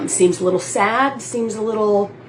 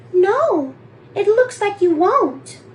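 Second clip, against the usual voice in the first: much higher, giggly woman's voice; mock blonde actress; 10 semitones higher, slower than usual. Reenacting the actress turning him down, high and giggly. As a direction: squeak it out as the cute blonde. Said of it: "She's a cute blonde".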